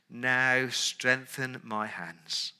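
slow, soft, deliberate plea; a hushed four-word prayer; slow simple prayer. Acted. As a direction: pray each word slowly.

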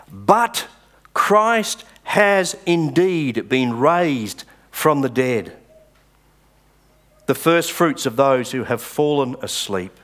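slow, weighty "But" to open the verse; triumphant verse, read slowly; scripture reading. Acted. Reads verse twenty aloud.

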